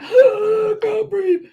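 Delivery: high, loud, very slow strangled gasp; mock gasping from a crushing hug; squashed in a hug. Friend crushed in a big hug, hammed-up strangled gasp. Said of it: "It'll be like".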